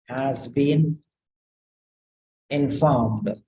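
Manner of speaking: teacher dictating a model sentence; slow dictation. Shown as a demonstration. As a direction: dictate it slowly word by word. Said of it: "you can change into different style".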